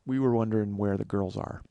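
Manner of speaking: low, subdued imitation of an awkward asker; mumbled-low, embarrassed ask, imitated; awkward request. Friend awkwardly asks the desk clerk.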